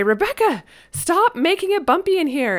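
teasing mom pretending the child made it bumpy; mom's playful scolding. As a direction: playfully scold the kid for the bumps. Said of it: "and she'd say".

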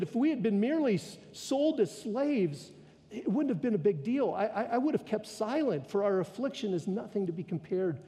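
a hint of a desperate queen begging the king; pleading queen. A narrator's reading. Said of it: "She said".

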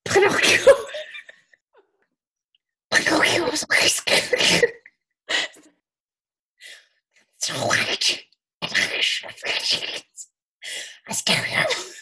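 airy, strained demon voice. Pretend. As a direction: croak it out like a scary movie demon. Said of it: "I sound like a demon in a scary movie".

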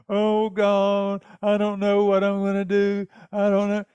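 mock self-pitying whine; whining. Someone begging God for pity, whining. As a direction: whine it out, drawn along on one note. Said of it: "Why are you whining like that?".

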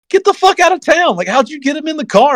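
high, astonished mock outburst; disbelieving yell. Buddy hearing who the co-driver is, astonished high exclaim. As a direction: play the stunned buddy, pitch shooting up.

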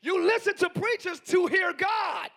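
loud, rising preacher's build; preaching at full force. Acted. Preacher urging the congregation to hear God, loud and driving. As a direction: press every phrase out to the room.

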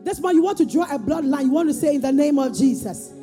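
high, slow fervent preaching; booming motivational speech. Real, not acted. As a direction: drive each declaration home. Leads congregation in declarations.

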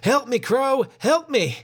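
narrator's restrained high-pitched scream; mock scream. A narrator's reading. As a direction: read a scream as a high, contained plea. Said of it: "Walker screamed".

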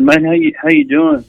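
high, breezy mock-innocent greeting. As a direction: ask casually, playing dumb. Retold sly greeting to tired Grant.

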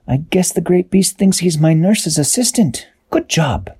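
high, amused character voice; Peso's voice. A narrator's reading. Peso praising the beast, high amused voice. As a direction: lift the voice, joking. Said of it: "Peso said".

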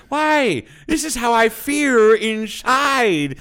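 loud, high, drawn-out mock Chinese accent; protesting in a slow caricatured Chinese accent. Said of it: "he's got the, he, like, squints".

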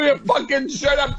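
sudden high yell; shouting. Real, not acted. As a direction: shout it down at your friend. Friends ribbing him on podcast.

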